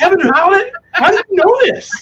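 high, squeaky disbelief; shocked disbelief. Real, not acted. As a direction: sound genuinely caught out. Caught off guard by story.

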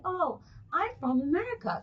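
hint of a well-spoken kid answering; proper little girl. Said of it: "she speaks so proper".

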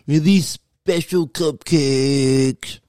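slow, drawn-out stoned question; stoner voice. Retold or imitated. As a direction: ask it like you're high, slowly. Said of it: "they have to say it like that".

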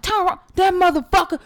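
high, animated mock outburst; imitating scary friend. She imitates a friend cussing out her boyfriend, mock outburst. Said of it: "She'd be like".

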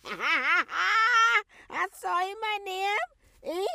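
mock crying. Mother mock-crying over daughter.

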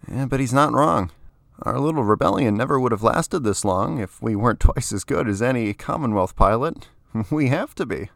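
a hint of a tough pilot; confident pilot voice. A narrator's reading. Lara voiced by narrator.

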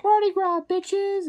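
put-on high, loud drunken yell; party shout. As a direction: whoop it like a reveler. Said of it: "you'd be like".

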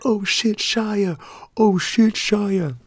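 high, gleeful "oh shit"; crowd egging on. Retold or imitated. Room erupting at Shia's putdown, high gleeful teasing. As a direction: cheer him on like the room did. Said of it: "We were all like".

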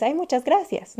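high, sugary thank-you; polite thanks. Retold or imitated. A guest politely thanking for juice she disliked, high and sweet. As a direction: lift it up sweetly to dodge the question. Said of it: "de pronto dices".

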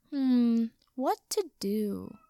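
drawn-out, theatrical pondering; musing. A narrator's reading. Wizard decides what to do with captives, slow musing. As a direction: ponder it slowly, toying with the captives. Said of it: "the wizard mused".

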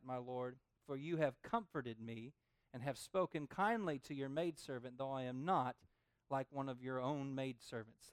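soft, measured Bible reading; scripture reading. Acted. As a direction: read the verses gently, like holy text.

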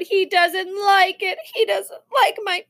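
high, slow tearful whine; drawn-out sobbing complaint, building to a wail; child crying. A narrator's reading. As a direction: wail like a hurt little boy. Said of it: "Sam cried".

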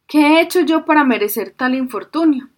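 put-on high, wailing old fisherman's lament. A narrator's reading. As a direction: cry out to the heavens in despair. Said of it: "se lamentó el anciano".

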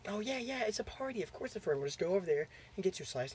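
high, softened brush-off of a worry; mock breezy reassurance. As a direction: play a brother coaxing his sister away. Brother brushes off sister's worry.

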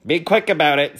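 testy bee; impatient spelling bee. Acted. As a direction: hurry them along. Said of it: "suggested the spelling bee".

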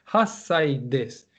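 hasai des said slowly with hachi merged into hasai; slow demo hasai des. Shown as a demonstration. Teacher modelling eight years old, slow. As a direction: drag out hasai des for learners. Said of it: "se transformaría en hasai".

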